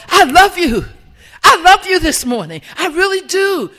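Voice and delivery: loud, high and full of feeling; heartfelt love. Shown as a demonstration. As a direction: pour your heart into it. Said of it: "coming from the heart".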